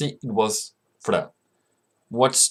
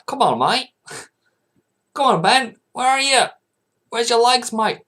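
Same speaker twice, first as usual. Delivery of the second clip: Aussie accent; 12 semitones higher, 5 dB louder than usual. Real, not acted. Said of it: "sometimes I sound like an Aussie".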